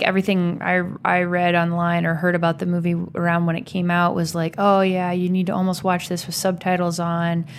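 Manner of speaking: ordinary delivery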